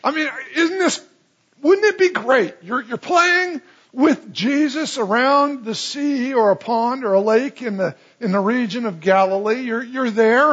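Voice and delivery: high, bright wonder, eager; excited. Real, not acted. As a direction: paint the scene with delight, voice up high. Excited imagining playing with Jesus.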